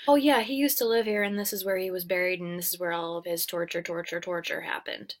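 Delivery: fast, fading rush through it; fast tossed-off exposition. Acted. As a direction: gabble the backstory quickly. Villain rushing through backstory.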